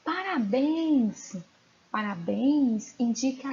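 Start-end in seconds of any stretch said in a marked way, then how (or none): 0.0-1.4 joyful exclamation. Shown as a demonstration. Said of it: "Olha essa outra".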